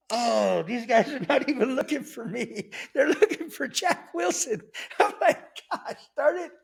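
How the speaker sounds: high-pitched, laughing retold dismay; amused mock dismay, cracking up; laughing realization. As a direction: squeal it through laughter. Laughs retelling his own realization.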